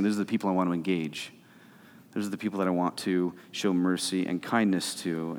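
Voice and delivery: the preacher voices Jesus welcoming outcasts, low and soft; tender Jesus voice. Retold or imitated. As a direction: speak it like a kind invitation.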